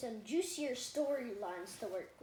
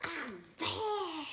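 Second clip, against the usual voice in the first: big entrance; 5 semitones higher than usual. Acted. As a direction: announce your return like a star. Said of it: "make a big entrance".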